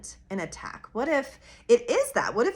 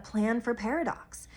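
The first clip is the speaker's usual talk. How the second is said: ordinary talk, nothing marked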